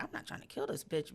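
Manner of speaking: hushed, breathless mutter; whispered. Retold or imitated. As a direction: whisper it under your breath. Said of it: "And I'm like".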